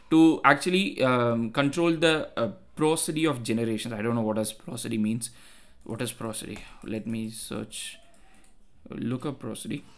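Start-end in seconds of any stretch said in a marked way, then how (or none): 5.8-9.8 low, soft thinking aloud while searching; talking to oneself. Real, not acted. Tutor looking up an unknown word mid-lesson, quietly to self. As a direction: say it quietly to yourself, slightly lower.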